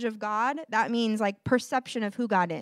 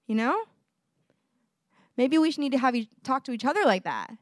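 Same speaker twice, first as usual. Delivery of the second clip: ordinary talk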